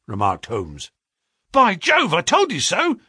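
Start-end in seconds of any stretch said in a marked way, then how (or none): 1.5-3.0 high, loud exclamation; excited clerk's outburst; excited cry. A narrator's reading. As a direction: cry out in triumph, pitched right up. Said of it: "cried the clerk".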